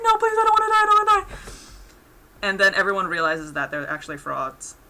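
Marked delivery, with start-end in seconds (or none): 0.0-1.4 high, loud panicky pleading; mock panicked begging. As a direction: squeal it like a coward begging for life. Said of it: "and they're like".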